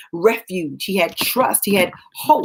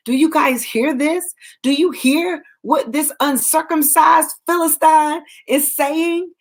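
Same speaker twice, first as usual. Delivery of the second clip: higher, pitched-up indignant demand; livid outrage; 7 semitones higher than usual. Retold or imitated. Preacher voicing David, furious at Goliath's taunts, higher indignant cry. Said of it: "I mean, he was livid".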